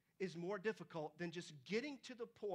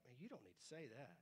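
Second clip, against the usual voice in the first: muttered aside; 6 semitones lower, 9 dB quieter than usual. Retold or imitated. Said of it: "that just is like".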